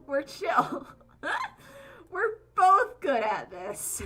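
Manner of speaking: high, laughing and slow; giggly and drawn out. Real, not acted. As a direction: laugh through the words. Laughing at mutual attacks.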